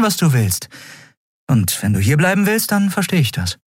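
high-pitched, earnest release; understanding captain. A narrator's reading. (verständnisvoll, mit höherer Stimme)

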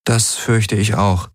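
slow, lame agreement; slow and half-hearted; lame reply. A narrator's reading. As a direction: agree lamely, dragging the words out. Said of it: "sagte Kirai lahm".

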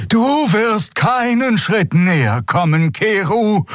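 hohe, langsame, drohende Stimme der alten Xea; strenge alte Draxül. A narrator's reading. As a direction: alte Frau, die sich einem Riesen entgegenstellt. Xea stellt sich Kero entgegen. (alte Xea warnt Kero drohend, hoch und langsam)

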